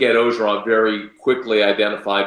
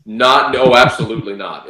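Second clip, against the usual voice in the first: normal talking voice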